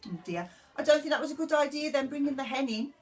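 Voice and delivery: usual speaking voice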